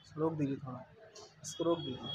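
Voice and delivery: very low, hushed aside; quiet aside. Real, not acted. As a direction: drop far down in pitch and volume, off to the side. Off-mic request to pause the recording. (धीमी, दबी आवाज़ में)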